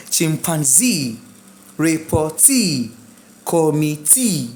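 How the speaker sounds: slow "Chimpanzee", "Reportee", "Committee" with long final EE; stress on final EE. Shown as a demonstration. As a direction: stretch the last syllable of "Reportee" and "Committee". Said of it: "do stress the other E E".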